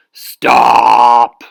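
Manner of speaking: huge booming shout; giant yell. Acted. Giant father halting the cars, booming yell. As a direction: roar at the traffic. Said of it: "and yelled".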